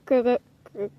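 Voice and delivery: stuck on "gor-", repeated false starts; stuttering. Real, not acted. Player trying to name an animal, stuttering "gor-, gor-". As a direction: stutter on the first syllable "gor-". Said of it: "I stuttered".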